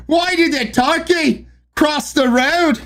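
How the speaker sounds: high-pitched mock Scottish; wobbly put-on Scottish joke read; Scottish accent. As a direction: attempt a Scottish accent, badly. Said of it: "Read the joke in a Scottish accent".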